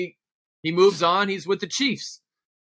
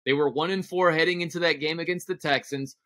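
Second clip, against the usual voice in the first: ordinary delivery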